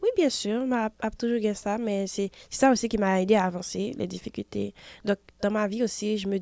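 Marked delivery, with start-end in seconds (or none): none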